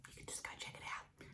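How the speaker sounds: hushed, near-voiceless aside; whispered aside. Real, not acted. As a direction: slip it in under your breath. Dropped aside to viewers.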